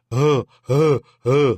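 hammed-up groaning "ah, ah"; imitated moaning cries. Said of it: "ellas hacían".

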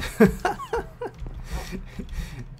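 long squeaky breathless giggle; high airy laugh. Real, not acted. Builder laughing at own ugly house, long high giggle.

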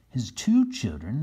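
ordinary delivery, nothing marked

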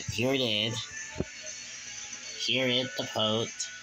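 usual speaking voice

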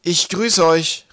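loud, high, drawn-out captain's hail; calling out. A narrator's reading. Twig greeting the figure as his father would, calling. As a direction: hail the stranger with a captain's authority. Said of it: "rief er der Gestalt zu, wie Wolkenwolf".

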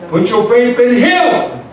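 high, slow, emphatic appeal; forceful preaching. Real, not acted. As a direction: drive it home slowly to the congregation.

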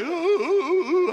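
loud, high drawn-out boo-hoo; imitated sobbing wail, hammed-up; mock wailing. Husband imitating his wife breaking down, mock crying. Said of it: "sister broke down and cried".

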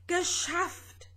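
high-pitched exclamation of relief; relieved groan. A narrator's reading. Vanya freed from the raging storm, relieved groan. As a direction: let it out high, relieved after straining. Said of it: "stöhnte der kleine grüne Vanya erleichtert".

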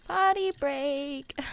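high, slow, drawn-out POTTY BREAK; playful singsong. Pretend.

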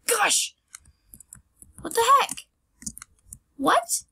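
real shocked outburst; surprised. Real, not acted. Stuck on the parkour map, high startled outburst.

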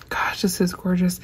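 hushed awe, breathed out; whisper. Real, not acted. Whispering to viewers over a gorgeous package. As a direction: whisper it like it's too pretty to disturb.

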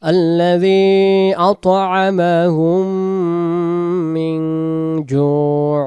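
slow, drawn-out chanted tilawat; Quran recitation. Shown as a demonstration. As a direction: recite it slowly with long held vowels. (ধীর তিলাওয়াতের সুরে)

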